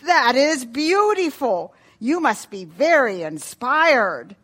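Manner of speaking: loud, slow, drawn-out praise; admiring father. Acted. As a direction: gush slowly over a child's artwork. Father praises his daughter's artwork.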